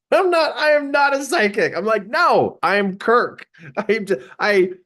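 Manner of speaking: high-pitched, flustered protest; flustered denial. Retold or imitated. Speaker protests to audience calling him psychic, flustered denial. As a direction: deny it insistently, pitch jumping up.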